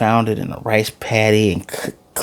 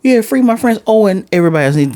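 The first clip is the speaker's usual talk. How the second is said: high, sarcastic whine; mock her selfish cheer, pitched way up; mocking Ruli; 10 semitones higher, faster than usual. Fan mocking Ruli's plea for her friends, high sarcastic whine. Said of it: "and then goes".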